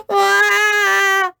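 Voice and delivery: high, loud wailing "ah"; sobbing cry. A narrator's reading. George cries as his dinosaur floats away, a loud wail. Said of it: "sobbed George".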